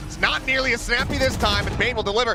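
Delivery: high, loud climax call; excited play-by-play. Real, not acted. Caster calling a loss in the ultimate, thrilled. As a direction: shout the big play to the crowd.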